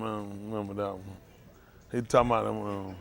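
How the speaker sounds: ordinary delivery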